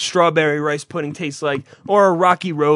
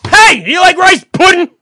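hammed-up high shout; screaming; 11 semitones higher, 10 dB louder than usual. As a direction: yell it like you can't judge your own volume.

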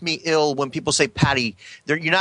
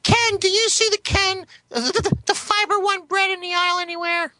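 pretend wife; 14 semitones higher than usual. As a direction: play the wife calling out in a high falsetto. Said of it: "pretend to be Amanda".

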